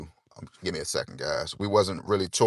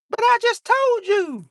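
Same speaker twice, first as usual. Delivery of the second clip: very high, loud mom voice, quick and scolding; mom's voice; 6 dB louder than usual. Retold or imitated. Son imitates his mom refusing gifts, high and scolding. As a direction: play his mother fussing at him. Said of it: "put on record".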